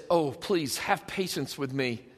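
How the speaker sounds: low, soft, humble plea; meek pleading. Acted. Servant begging his creditor for patience, meek pleading. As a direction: beg quietly, voice sinking.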